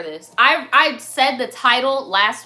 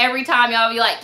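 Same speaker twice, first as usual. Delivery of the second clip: ordinary talk, nothing marked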